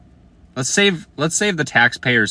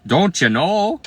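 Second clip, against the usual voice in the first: lightly mocked Minnesota accent, pitched higher; a quick playful Minnesota "Don't you know?"; teasing a viewer with a hint of Minnesota accent; 8 semitones higher, slower than usual. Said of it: "I'm from Minnesota".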